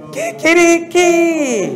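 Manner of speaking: a high, slow kikiriki crowed out; Venezuelan rooster crow. Shown as a demonstration. Teacher shows the class the Venezuelan rooster crow. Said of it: "How do roosters crow in Venezuela?".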